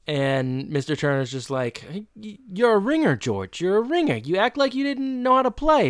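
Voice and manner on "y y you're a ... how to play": high-pitched whining accusation; exhausted sore loser, imitated. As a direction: complain in a high voice at being hustled. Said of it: "Mister Turner is the exhausted one".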